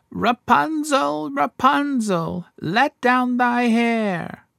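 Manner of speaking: high, slow witch call up the tower; enchantress calling. A narrator's reading. Enchantress calls up to Rapunzel in her tower, high slow witch voice. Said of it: "he heard how she cried".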